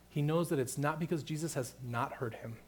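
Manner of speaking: usual speaking voice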